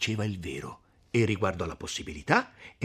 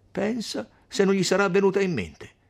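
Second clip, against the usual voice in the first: higher, louder of-course retort; scoffing; 12 semitones higher, 6 dB louder than usual. Acted. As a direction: dismiss the naive question. Agnese scoffs at naive question. (tono sprezzante, ovvio)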